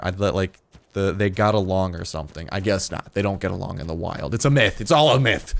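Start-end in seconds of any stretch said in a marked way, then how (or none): 4.3-5.5 louder, higher mock proclamation; mock outcry. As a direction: cry it out like a conspiracy nut. Mock outcry over debunked rumour.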